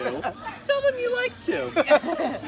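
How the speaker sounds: slow, high words squeezed through laughter; laughing read. Real, not acted. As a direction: drag it out while giggling. Reading the letter, laughing.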